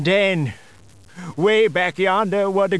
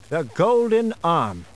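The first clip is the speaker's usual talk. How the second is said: slow, weighty title announcement; story title; slower than usual. A narrator's reading. Storyteller announcing the tale to listeners, slow and weighty. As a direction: set up the tale with gravity.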